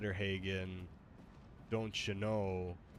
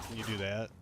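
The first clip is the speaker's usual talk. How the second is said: ordinary talk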